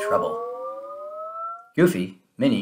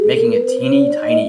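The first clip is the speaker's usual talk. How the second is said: much higher squeaky voice; tiny voice; 7 dB louder than usual. A narrator's reading. Narrator making the balloon sound tiny, squeaky high. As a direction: shrink your voice to tiny. Shrinking described.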